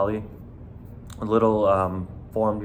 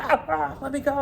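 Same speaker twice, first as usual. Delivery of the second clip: much higher, strained mock yelping; panicked struggle. Kid held by the bouncer, high panicked pleading. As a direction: squeal to be let go, pitched way up.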